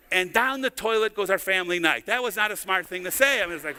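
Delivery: ordinary talk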